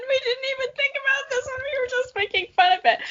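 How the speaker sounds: high voice squeezed through laughter; talking mid laughing fit; giggling so hard the pitch jumps way up; laugh-crying. Real, not acted.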